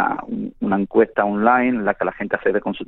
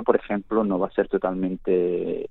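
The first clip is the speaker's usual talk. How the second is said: normal talking voice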